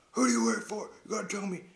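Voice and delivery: loud, high-pitched demanding shout; hammed-up shouted demand; mock interrogation. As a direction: play Bond yelling questions at a dangling man. Acted interrogation over cliff.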